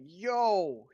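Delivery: loud, high call of yo; shouted yo. Retold or imitated. Teen grandson yelling across the room, loud call. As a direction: belt out a greeting.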